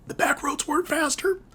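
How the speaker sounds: very high, strained comic squeak; squeaky despair. Acted. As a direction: wail it in a tiny pinched high voice. Comic despair at wrong guess.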